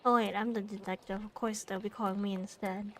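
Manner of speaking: normal talking voice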